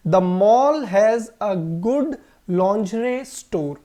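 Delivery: very slow, word by word; slow model sentence. Shown as a demonstration. Teacher reads the example sentence to the class very slowly. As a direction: dictate it slowly. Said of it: "और यहाँ पे sentence क्या है?".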